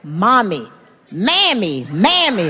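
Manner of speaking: mammy with a flat a, drawn out; kid's mammy. Retold or imitated. Mocking his kids saying mammy instead of mommy. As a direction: say mammy like a small child mangling mommy. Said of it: "teach you how to say mommy".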